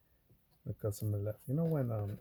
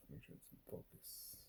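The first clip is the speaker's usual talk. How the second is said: whisper; 21 dB quieter than usual. Real, not acted. Whispered filming aside.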